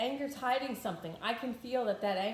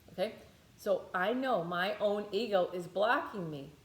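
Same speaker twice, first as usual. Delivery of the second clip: ordinary talk, nothing marked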